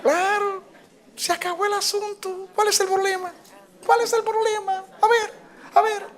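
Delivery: very high, light and playful; airy high-pitched what's-the-problem; high mocking shrug. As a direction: toss it off in a high carefree voice. Laughing shrug at own failing.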